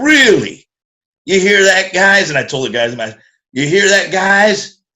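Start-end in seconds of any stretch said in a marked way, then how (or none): 0.0-2.3 mock outrage. Mocking to the band. 3.5-4.8 calling to the guys. Retold or imitated. Calling out to the band.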